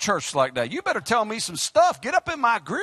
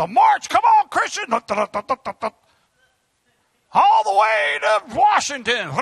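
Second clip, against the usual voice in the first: fiery shouting preaching; 7 semitones higher than usual. Real, not acted. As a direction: let the sermon burst out at full force. Preaching at full force.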